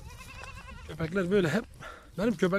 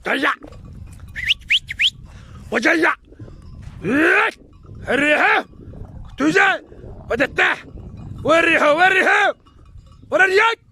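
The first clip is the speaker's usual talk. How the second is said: loud high shout across the field; herding shout; 10 semitones higher, 5 dB louder, slower than usual. Shown as a demonstration. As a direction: yell it out to the flock, louder each time. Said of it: "Böyle işte bağırıyorsun".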